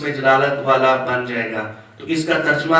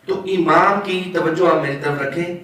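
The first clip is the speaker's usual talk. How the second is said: ordinary delivery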